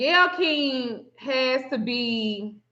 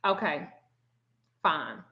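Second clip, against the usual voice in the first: curt acceptance; 8 semitones lower, 8 dB quieter than usual. Retold or imitated. Producers' retold resigned reply.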